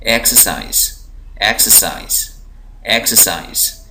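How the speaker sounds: Exercise with one syllable pressed, falling at the end; stressed syllable. Shown as a demonstration. Teacher showing students word stress, stressed syllable. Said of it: "ज़ोर दे के पढ़ रहा हूँ".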